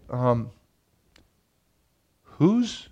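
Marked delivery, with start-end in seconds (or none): 2.2-2.9 stern father. Retold or imitated. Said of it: "he's like".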